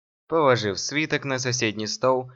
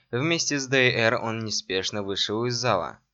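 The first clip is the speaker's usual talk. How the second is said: ordinary delivery, nothing marked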